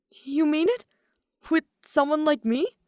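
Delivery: high, loud, thrilled; can't believe her luck; excited disbelief. Acted. Yoshiko thrilled that Daya agreed to a date, high and loud.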